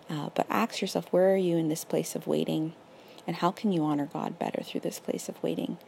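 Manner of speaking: normal talking voice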